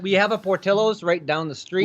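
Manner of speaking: hammed-up Chicago local; Chicago accent, bragging about the neighborhood. Shown as a demonstration. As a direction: lay on a thick Chicago accent. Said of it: "We do have the Chicago accent".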